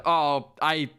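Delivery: ordinary talk, nothing marked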